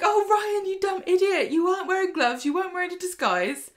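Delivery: mock exasperation. Mocking Ryan on the footage.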